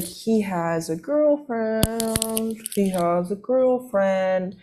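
very slow, stretched-out words; exaggerated slow-motion objection; pointed reminder. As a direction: drag out each word as a mock warning. Retold pointed objection to roommate.

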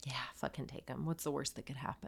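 hushed, sneaky encouragement; egging her on in a hushed voice; conspiratorial whisper. Real, not acted. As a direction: whisper it like a secret. Conspiratorial advice.

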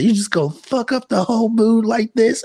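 high-pitched imitation of her indignant question; mock upset. Repeating what she snapped at her friend, mock upset. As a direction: retell her complaint way up high, rising at the end. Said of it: "when she said it like that".

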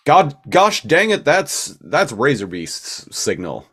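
loud, high, urgent call; excited shout. Acted. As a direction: burst out like a hero who catches the signal. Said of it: "and be like".

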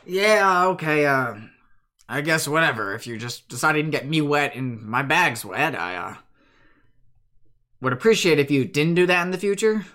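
lightly put-on cowboy Western voice, miffed. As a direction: grumble it like a Western cowhand. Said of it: "I just give Seth the Western voice".

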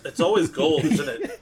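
loud, laughing interjection; laughing as you say it; amused. Real, not acted. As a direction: jump in loudly through a laugh. Joking aside about gold doors.

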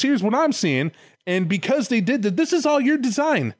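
high, building mock indignation; hammed-up courtroom-style accusation; accusing Lucifer. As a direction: drive the blame home, pitch climbing. Acted Lucifer blaming God.

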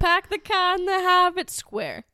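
loud, slow "Park the car in the Harvard Square" with dropped Rs; hammed-up Boston accent, dropping the Rs on "car" and "Harvard"; Boston accent demo. Shown as a demonstration. Co-host showing how the Boston line should sound, loud Boston accent. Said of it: "what part of square sounds good there?".

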